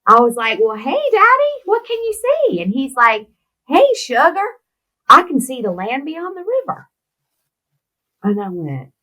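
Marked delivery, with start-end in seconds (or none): 0.6-2.6 very high, sing-out question to a parent; coaxing question. Retold or imitated. Daughter retelling how she asked Daddy what he saw, high coaxing. Daughter talking to her dying father. 3.7-6.9 high-pitched imitation of a father in wonder. Daughter imitating father seeing beyond the river, high and wondering.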